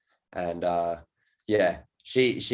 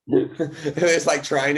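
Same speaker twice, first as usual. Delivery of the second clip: laughing; 7 semitones higher, 5 dB louder, much slower than usual. Real, not acted.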